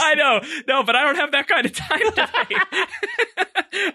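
real laughter stretching each word out; helpless giggly talk; laughing. Real, not acted. Still laughing at the joke.

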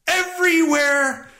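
high, slow, drawn-out shout; emphatic. Real, not acted. Repeats it louder for emphasis.

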